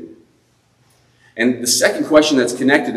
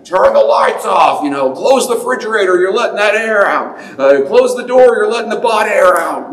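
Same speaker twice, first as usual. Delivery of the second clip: high, louder nagging parent; parents shouting; 9 semitones higher, 4 dB louder than usual. Retold or imitated. Parents yelling at their child about lights, mock shout. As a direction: scold like a parent. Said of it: "shouted at me".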